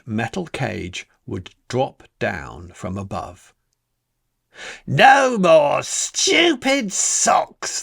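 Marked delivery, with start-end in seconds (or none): 4.9-7.8 high, loud and very slow gloat; gloating boy. Acted. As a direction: stretch it out with greedy relish. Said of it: "thought Bradley".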